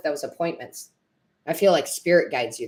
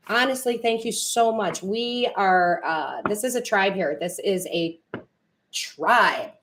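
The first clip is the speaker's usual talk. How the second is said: ordinary talk, nothing marked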